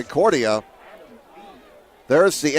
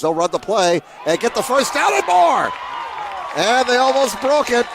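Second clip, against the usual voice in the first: rising roar over the long gain; excited play call; 7 semitones higher than usual. Real, not acted. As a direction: ride the run like a big game-changing play. Big first down run call.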